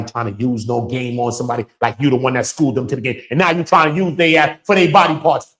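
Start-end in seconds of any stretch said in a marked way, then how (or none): 3.3-5.6 louder and higher, furious; angry shout. Real, not acted. Scolding men who use young women, angry shout. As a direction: call them out at full volume. Ranting at exploiters.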